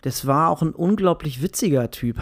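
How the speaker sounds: normal talking voice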